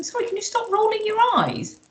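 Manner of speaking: high, pointed request to stop; retold request to stop eye-rolling, pitched high. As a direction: pitch it up as a firm pointed ask. Asking someone to stop eye-rolling.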